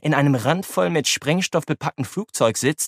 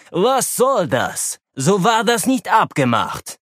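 angry demand; 7 semitones higher, slower than usual. Acted. Kasakow confronts pilots.

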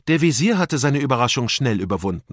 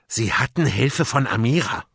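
usual speaking voice

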